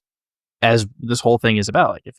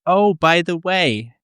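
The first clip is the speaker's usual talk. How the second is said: high, drawn-out Brooklyn grandmother impression; lightly voiced Brooklyn granny, pitched up; Brooklyn grandma; 8 semitones higher, slower than usual. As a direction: imitate a grandma from Brooklyn, stretching it out. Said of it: "she's from Brooklyn".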